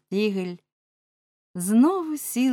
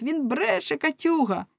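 high accusing cry from the mob; crowd cry; 9 semitones higher than usual. A narrator's reading. People accusing the fishmonger in court, crying out. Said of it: "кричав народ".

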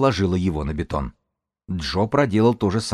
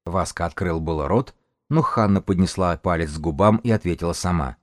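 plain reading voice

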